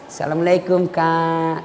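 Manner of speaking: soft, sugary greeting; girl sweetly greeting her boyfriend; mock sweet greeting. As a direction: coo the greeting.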